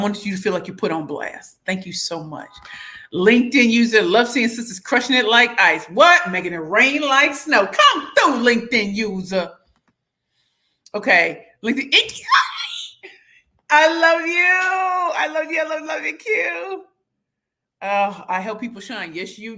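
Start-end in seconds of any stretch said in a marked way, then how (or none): 3.1-9.5 hype shout-out. Real, not acted. Hyping a commenter on live. 11.8-16.8 high, loud, shouted love; delighted high shout; gushing squeal. Real, not acted. Gushing at a commenter.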